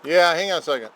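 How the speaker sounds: yelling out. Real, not acted. Vlogger interrupted while recording, yelling back to someone. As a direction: holler back to someone off to the side. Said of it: "sorry about the yelling in there".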